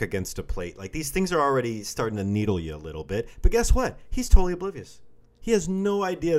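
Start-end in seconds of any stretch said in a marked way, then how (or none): 3.4-6.4 loud and high, emphatic. Real, not acted. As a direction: burst out loud with the twist.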